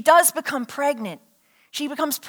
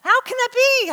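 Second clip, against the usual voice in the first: high-pitched bafflement; baffled priest questioning an angel; incredulous question; 10 semitones higher than usual. Acted. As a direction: ask it high, in disbelief. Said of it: "He's like".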